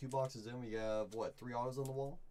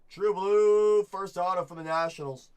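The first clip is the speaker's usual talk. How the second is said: much higher and louder, thrilled cry; excited shout; 8 semitones higher, 15 dB louder than usual. Real, not acted. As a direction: shout it out over a great pull. Big pull excitement.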